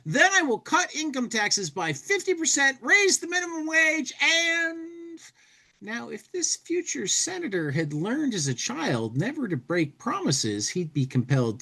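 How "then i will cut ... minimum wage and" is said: hammed-up politician promising everything; campaigning politician. Host reading the congressional side, hammed-up campaign speech. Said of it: "I'll take the congressional side".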